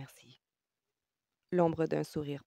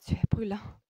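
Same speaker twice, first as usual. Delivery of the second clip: breathy, amazed stage whisper; in a breath; 5 semitones higher, 5 dB louder than usual. A narrator's reading. Woman feeling his feverish forehead, breathed in amazement. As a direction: gasp it out on a breath. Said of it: "dit-elle dans un souffle".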